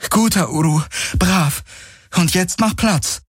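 high-pitched praise to an animal; delighted praise. A narrator's reading. Kirai praises Uru for obeying, delighted. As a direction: praise it, pitch lifted, then command.

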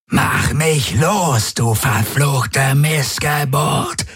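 high, drawn-out enraged roar. A narrator's reading. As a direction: roar the curse slowly, pitched well up, without full volume. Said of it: "brüllte er".

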